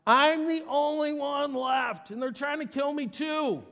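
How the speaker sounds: high, loud cry of grievance; gut-honest outburst. Acted. Elijah lays it out to God, shouting in protest. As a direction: burst out shouting, stretching the first words. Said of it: "he is just so gut honest".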